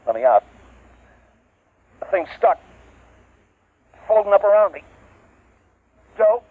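rising terror, pitch climbing high; desperate, trapped and screaming for help; panicked cries. Acted. Trapped, being digested, calling.

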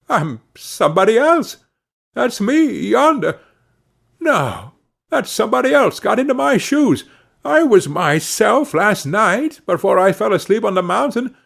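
high, bewildered, mounting distress; at wits' end. A narrator's reading. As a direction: plead in utter confusion, pitched well up. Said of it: "exclaimed he, at his wits' end".

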